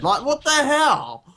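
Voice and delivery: high, drawn-out disbelief; incredulous. Real, not acted. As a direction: stretch it out loud and high, aghast. Reacting to blade over head.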